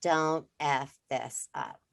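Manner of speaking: slow, heavy, stern inner voice; slow inner warning. Retold or imitated. Mother voicing the slow stern voice in her head. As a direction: drag each word out like a warning. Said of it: "there is a voice that says".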